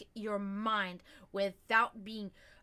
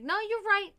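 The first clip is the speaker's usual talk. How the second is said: high, louder mock concession; exaggerated, pitched-up agreement; 9 semitones higher, 6 dB louder than usual. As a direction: imitate someone teasingly agreeing. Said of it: "he's mocking me".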